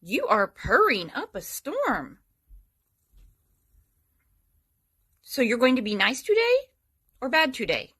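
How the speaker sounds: soft, high, sing-song cult member cooing to the cat. Real, not acted. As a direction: coo at the kitty like a serene cult member. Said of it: "I sound like a cult member".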